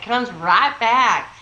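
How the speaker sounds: drawn-out Texas drawl; Texas y'all accent. Shown as a demonstration. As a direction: stretch the words slowly in a Texas accent. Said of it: "lost your y'all accent".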